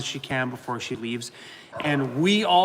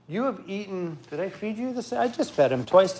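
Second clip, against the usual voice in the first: normal talking voice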